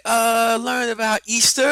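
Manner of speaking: put-on high, loud little-kid voice; mock preschooler reply; imitating young daughter. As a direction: answer as a small child, high and loud. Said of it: "She's like".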